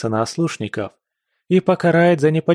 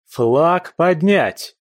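commanding shout; 5 semitones higher, much slower than usual. Acted. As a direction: bellow the order to raise the flag. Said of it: "громогласно повелел".

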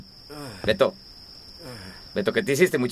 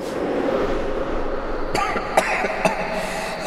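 coughing fit. Acted. Old man coughing fit.

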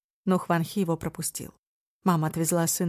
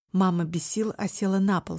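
ordinary delivery, nothing marked

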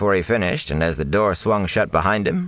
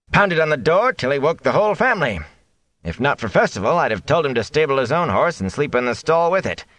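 higher, disapproving innkeeper voice; 8 semitones higher than usual. A narrator's reading. Innkeeper complaining about the gleeman, higher and disapproving. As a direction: tut at the late-night guest.

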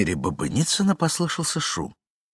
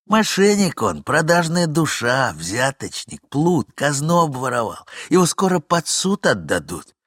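angry accusation; 6 semitones higher, 5 dB louder than usual. Acted. Young man rails at rival.

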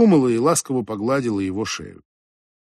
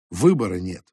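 usual speaking voice

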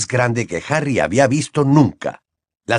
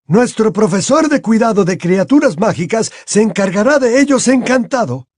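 a raised, courteous headmaster; Dumbledore voiced; 10 semitones higher, 3 dB louder than usual.

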